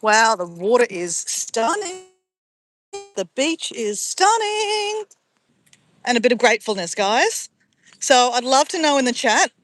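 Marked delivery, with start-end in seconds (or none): 0.0-5.0 real delight. Real, not acted. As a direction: marvel at the view, drawing out each phrase. Admiring the beach on arrival.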